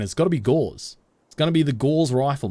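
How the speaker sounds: "Gauze" with a long aw vowel; Australian-accent Gauze. Shown as a demonstration. Streamer insisting on Australian accent, "Gauze" with aw. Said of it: "it's in an Australian accent".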